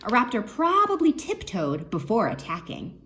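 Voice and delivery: usual speaking voice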